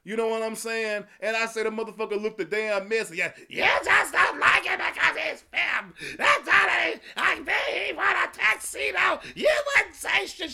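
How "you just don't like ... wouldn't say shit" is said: high, loud, whiny mock voice of online critics; mocking imitation of critics. Mocking his online critics in a high whiny voice. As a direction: parody the people who dragged him, high and shrill.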